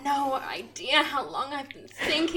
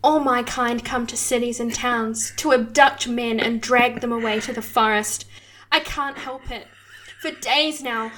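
normal talking voice

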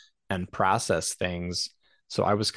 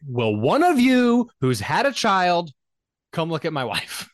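higher, louder and slow, exasperated demand; raised voice; 8 semitones higher, 5 dB louder than usual. Retold or imitated. Husband demands family come check his wife, loud and exasperated. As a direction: finally snap and make them listen. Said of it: "the first and only time I ever raised my voice to my mother-in-law".

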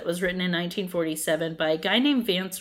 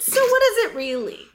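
high mock question; 12 semitones higher, 3 dB louder than usual. Playful mock-serious question.

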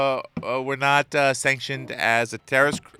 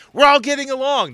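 mock rallying cry; 9 semitones higher, 7 dB louder than usual. Mock speech for fart cause.

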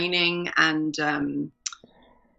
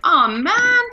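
higher, louder mock groan of letdown; retold comic letdown, higher and louder; mock dismay; 12 semitones higher, 8 dB louder than usual. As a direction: ham up the disappointment. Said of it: "And I was like".

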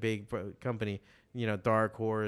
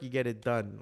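usual speaking voice

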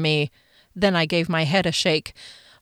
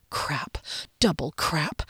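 muttered curse; 4 semitones lower, 7 dB quieter, slower than usual. Acted. Dread at being late.

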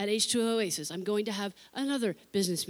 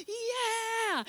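put-on cheering teens; 12 semitones higher than usual. Teens cheering after pushing.

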